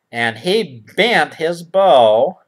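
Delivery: ordinary talk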